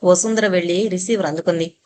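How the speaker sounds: ordinary delivery, nothing marked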